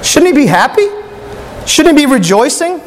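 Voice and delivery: preacher puzzling over Jonah to the church, incredulous. Real, not acted. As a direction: ask like it makes no sense. Preacher questions Jonah's attitude.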